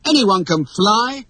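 put-on high, slow, assured boy; self-assured, lilting claim; confident. A narrator's reading. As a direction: state it breezily, sure of it. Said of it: "said Peter confidently".

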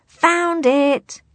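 high loud triumphant shout; grisly cry. Acted. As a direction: shout it across the island. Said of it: "came a grisly cry".

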